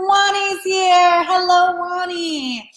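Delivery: high, drawn-out singsong hello; excited greeting. Real, not acted. Host hails Wani as she joins the livestream, excited greeting. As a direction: greet a friend with delighted, stretched-out high notes. Calling out to a viewer joining.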